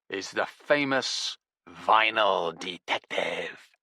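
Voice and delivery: famous drawn out slowly with sneer; sarcastic stress on famous. A narrator's reading. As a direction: put scare quotes on famous, slow. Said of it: "air quotes around the word famous".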